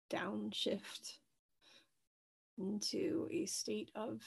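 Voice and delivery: soft, unhurried meditation voice with long pauses; guided meditation. Acted. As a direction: gently guide listeners into their breath, leaving space between phrases. Leads a guided breathing meditation.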